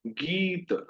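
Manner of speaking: गीत with the ee stretched; long गीत. Shown as a demonstration. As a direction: draw out ee in गीत. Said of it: "हम इसको थोड़ा खींच रहे हैं".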